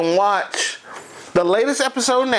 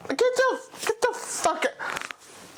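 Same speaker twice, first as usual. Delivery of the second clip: strained disbelief; 3 dB quieter, slower than usual. Acted. Peak of the outraged rant.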